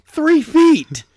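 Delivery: high, loud sputtering protest; mock outrage. Character scolding the teleporter, mock high outrage. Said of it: "It's like".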